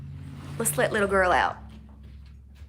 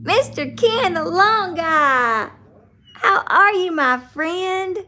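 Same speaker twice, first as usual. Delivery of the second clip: high greeting; 8 semitones higher, 5 dB louder, slower than usual. Real, not acted. Greeting a viewer, pet-like calling voice.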